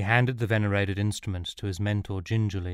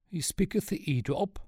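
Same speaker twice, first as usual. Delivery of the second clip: high-pitched professor, questioning; old professor; 9 semitones higher than usual. A narrator's reading. Professor asking about Sean's slang, in a high character voice. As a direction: voice a surprised scholar, pitched high.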